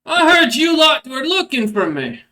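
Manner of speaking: loud, high confrontational troll boss; Red Maw. Acted. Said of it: "Red Maw just kinda looks".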